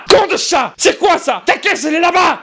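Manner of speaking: high, furious yell at the cat; hammed-up outraged shouting; angry yelling. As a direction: explode at the cat, high-pitched. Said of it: "je me mets un peu en colère".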